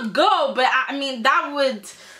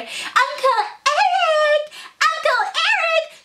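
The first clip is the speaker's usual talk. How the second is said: put-on high, slow, drawn-out childish call; sing-song it much higher like an eager niece; mock calling out to a favourite uncle, much higher and slow; calling Uncle Eric; slower than usual. [name] voiced calling out.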